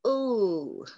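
ordinary talk

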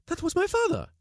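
very high astonished cry; wife realising the dead man was her father, crying out; cried out. A narrator's reading. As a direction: shout it, stunned. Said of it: "cried out".

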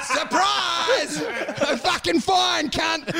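high-pitched, drawn-out gleeful surprise; mock triumphant cry. Springing a Trojan horse gag, high mock-triumphant cry. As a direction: call it out high and stretched.

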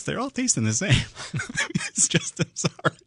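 laughing. Real, not acted. Cracks up mid-story, apologizes.

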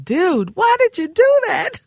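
high, loud mock outrage; comic, hammed-up disbelief shooting way up in pitch; outraged fan. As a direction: squawk it like an appalled fan. Imagines outraged fans reacting.

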